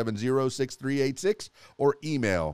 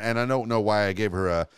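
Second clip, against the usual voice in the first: ordinary talk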